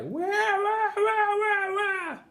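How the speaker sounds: put-on very high baby wail of wah; high, drawn-out infant wah-wah; crying baby. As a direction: wail like a newborn in a knapsack. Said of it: "started to cry".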